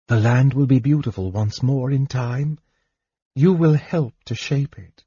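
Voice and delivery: a hint of a loving daughter's voice; gentle daughter. Daughter comforts weeping father.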